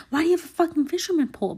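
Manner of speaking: ordinary delivery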